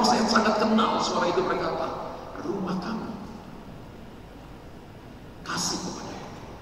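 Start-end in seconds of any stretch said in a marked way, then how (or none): 2.4-6.5 quiet inner voice. Retold or imitated. A quiet voice tells the praying man to give, hushed. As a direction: retell a gentle voice heard in prayer. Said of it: "suara yang pelan".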